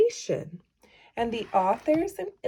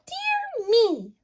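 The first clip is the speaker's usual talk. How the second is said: high, worried little mouse voice; fretting mouse; 14 semitones higher than usual. Acted. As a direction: fuss like a tiny worried mouse. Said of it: "Mouse frets".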